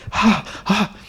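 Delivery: mock the staff's forced laugh; forced fake laugh. Staff laughing nervously at the boss, fake. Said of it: "suddenly they're like".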